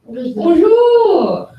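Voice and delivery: high, bright-loud classroom bonjour; cheery greeting. Real, not acted. Teacher greeting students on Monday morning, cheery.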